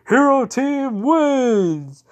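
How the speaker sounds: long, high, booming victory call; announcer-style victory shout, stretched out; triumphant announcement. Acted. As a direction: proclaim the winners, drawn out and loud. All Might announces the victory.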